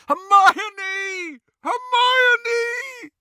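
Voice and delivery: high, loud anguished bellow. Acted. Ron bellows for Hermione upstairs, anguished loud bellow. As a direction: bellow her name in panic. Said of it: "Ron was bellowing".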